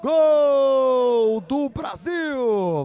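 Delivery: long held goal shout, high and loud; explosive goal commentary. Real, not acted. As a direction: stretch out the goal call at full power.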